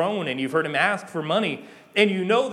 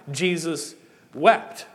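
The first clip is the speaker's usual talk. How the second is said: slow, solemn; 3 dB louder, much slower than usual. Acted. Preacher slows for the verse.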